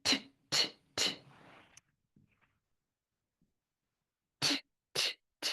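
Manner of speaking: crisp isolated ঠ as the t sound; t sound drill; letter sound demo. Shown as a demonstration. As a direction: tap out ঠ short, repeatedly. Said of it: "And then another sound".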